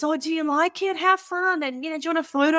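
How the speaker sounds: high, sing-song mock auntie; imitating cheery auntie. Auntie voiced, cheerfully offering.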